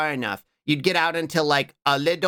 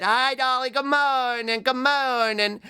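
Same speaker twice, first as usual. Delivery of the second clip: high sing-song hello, a little teary; cutesy greeting; 9 semitones higher, slower than usual. Real, not acted. Streamer greets a viewer in chat, high and teary.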